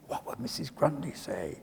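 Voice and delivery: a hushed, ominous aside; hushed echo. Shown as a demonstration. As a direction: let the question fall to a fearful hush. Echo of the dreaded question.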